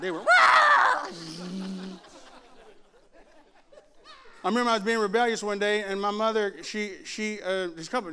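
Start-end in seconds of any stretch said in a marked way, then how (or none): 0.2-2.0 loud, high squeal of peeling-out tires; screeching tire noise. Retold or imitated. Parents racing off after drop-off, mimicked tire screech. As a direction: imitate rubber burning on the getaway. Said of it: "I could hear rubber burning".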